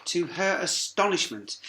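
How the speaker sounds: usual speaking voice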